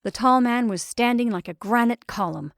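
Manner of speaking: ordinary delivery, nothing marked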